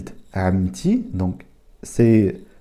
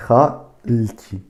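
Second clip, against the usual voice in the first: normal talking voice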